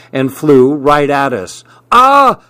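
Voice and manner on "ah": high, loud startled yell of "Ah!"; startled shout. A narrator's reading. Pete yelling as the chicken flies at him. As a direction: yelp as something flies at your face. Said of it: "Pete shouted".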